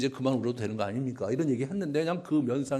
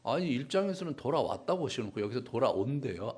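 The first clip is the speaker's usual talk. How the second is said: ordinary delivery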